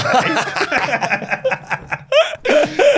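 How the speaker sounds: loud high burst of laughter; big laugh. Real, not acted. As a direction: crack up loudly at the joke. Hosts laughing about cocaine.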